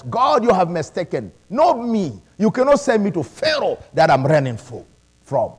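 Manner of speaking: ordinary delivery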